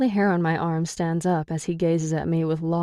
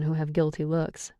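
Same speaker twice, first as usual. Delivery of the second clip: ordinary delivery, nothing marked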